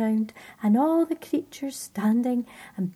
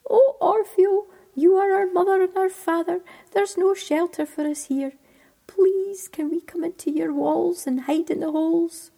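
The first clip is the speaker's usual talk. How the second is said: high, piping little voices pleading; tiny creatures begging in a high pipe; 7 semitones higher, 4 dB louder than usual. Acted. Frozen insects begging Orfeo for shelter, high piping plea. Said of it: "a piping voice rose".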